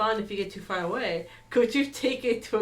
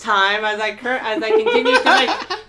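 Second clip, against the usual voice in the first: laughing; 3 semitones higher, 10 dB louder, slower than usual. Real, not acted. Outro said through laughter.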